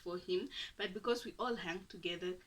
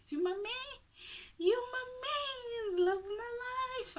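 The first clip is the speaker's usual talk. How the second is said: mock lovestruck. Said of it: "I was like".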